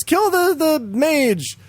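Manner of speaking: high-pitched mock order; game's command. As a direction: issue the objective like an excitable game. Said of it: "it says".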